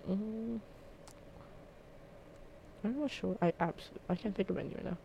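ordinary talk

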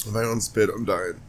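recovering from choking; choking on a drink. Real, not acted. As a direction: still catching breath after coughing. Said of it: "Sorry, I was choking".

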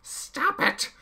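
loud, very high screaming rage. A narrator's reading. Chaos screams at Jim against the wall. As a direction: scream it in his face. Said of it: "he screamed".

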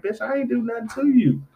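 high-pitched indignant denial; squeaky retold objection; mock indignant protest. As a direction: protest innocence to a pet, hammed-up. Protesting to the growling dog.

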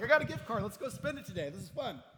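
excited. Retold or imitated. Pastor mocking his old spending self, giddy excitement. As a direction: squeal about a gift card.

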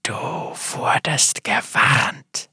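slow, whispered growl in the ear. A narrator's reading. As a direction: growl it right into his ear. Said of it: "knurrte ihm eine Stimme ins Ohr".

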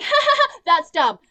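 high, loud fake laugh, then scoff; mocking Malia laughing off the truth; mock dismissive laugh. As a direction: cackle it off like it's nonsense. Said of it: "she's like".

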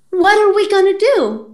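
panicked mom. Retold or imitated. Mom panicking after stabbing.

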